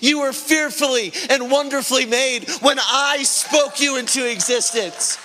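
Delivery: preaching. Acted. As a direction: push the hope home to the congregation.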